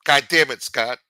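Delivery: loud exasperated curse; real exasperated shout at Scott; frustrated outburst. Real, not acted. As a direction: blurt it out, fed up.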